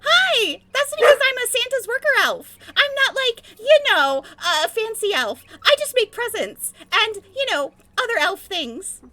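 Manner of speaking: high, loud Christmas worker elf. Acted. Jingles the elf answering a teasing co-player, high and perky. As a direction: pipe up as an excitable little elf. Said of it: "preview of your elf voice".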